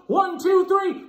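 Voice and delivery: high loud shouted countdown; loud count. Shown as a demonstration. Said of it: "On the count of three".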